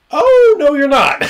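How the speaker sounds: high, loud mock outcry of disbelief; a squealed, exaggerated "oh no you don't"; shocked outcry. GM to metagaming player, high loud mock protest.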